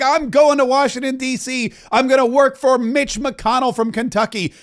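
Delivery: mock eager young aide, high-pitched; imitating an excited new hire; all excited. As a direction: gush it like big news for mom. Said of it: "He's all excited".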